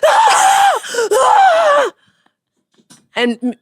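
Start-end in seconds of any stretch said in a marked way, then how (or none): 0.0-1.9 very high, loud mock scream; retold scream. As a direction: shriek like a boy scared in the shower. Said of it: "you just hear the".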